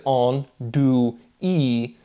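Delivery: "on, do, e" one syllable at a time, no le; broken into syllables. Shown as a demonstration. Showing which sounds French keeps, syllable by syllable. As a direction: spell out "on, do, e" by sound, stopping before le. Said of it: "why is the le off?".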